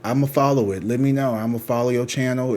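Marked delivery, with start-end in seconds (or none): none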